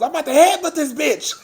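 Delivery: high, rushed imitation of an angry threat; mock threat. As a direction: blurt it out like someone itching to fight. Voices angry threat overheard on call.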